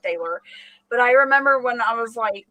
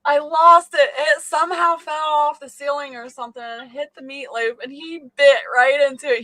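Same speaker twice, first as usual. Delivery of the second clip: usual speaking voice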